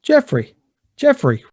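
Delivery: high, falling call of recognition; calling out to brother. Acted. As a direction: call his name across the room, startled. Player calls out to found brother.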